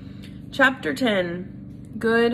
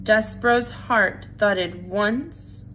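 usual speaking voice

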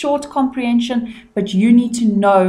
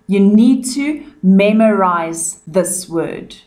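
ordinary delivery

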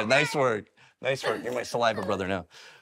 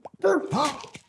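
sharp high gasp; puffer fish gasp. Pretend. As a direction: puff up with a gasp. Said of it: "my impression of a puffer fish".

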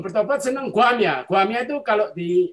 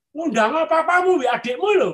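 higher-pitched retold retort; hurtful rebuff; 7 semitones higher, 5 dB louder than usual. Invited neighbour refusing the preacher, stinging rebuff. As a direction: imitate someone brushing off an invitation. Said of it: "yang menyakitkan ya".

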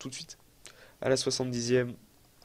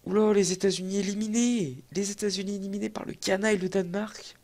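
higher surprised reading of "les États-Unis éliminés"; 7 semitones higher than usual. Real, not acted. Gamer reading shock results, surprised higher voice. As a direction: react with disbelief at the news.

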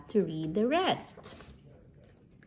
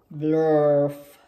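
loud, low goofy blurt; silly loud word; 5 semitones lower, 7 dB louder than usual. Acted. Reading silly book word loudly.

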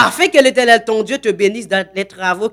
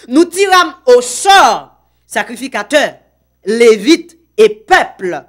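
solemn scripture reading; 5 dB louder, slower than usual. Acted. Lecture solennelle du verset.

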